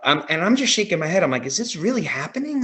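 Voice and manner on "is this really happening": pitched way up, breathless with disbelief; like you can't believe your eyes; stunned disbelief. Retold or imitated. Recalling Cody's match in front of him, stunned high disbelief. Reliving disbelief at the live event.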